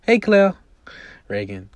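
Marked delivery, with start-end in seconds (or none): 0.0-0.5 loud, high bright hello; light up at seeing her friend; best friend greeting Claire happily on the bus; happy greeting. Acted. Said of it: "who's very happy to see Claire".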